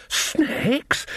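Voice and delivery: high, breathless squeak of disbelief; wheezed. A narrator's reading. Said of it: "Nanny wheezed".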